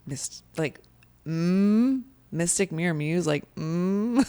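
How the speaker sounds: hesitant, unconvinced mm; a lightly voiced puzzled skeptic; dubious friend. Someone else reacting to the logo, unconvinced and hesitant. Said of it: "they're like".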